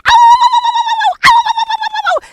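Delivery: loud high baying howls; bay like hounds on the chase; howling dogs. Acted. Hounds chasing the thing into the swamp, loud high howling.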